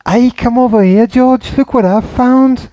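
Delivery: high, loud American take on a Liverpool [name] voice; mock [name] accent. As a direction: play a Beatle calling his mate George, hammed-up Liverpool accent. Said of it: "I'm doing the American version of the [name] accent".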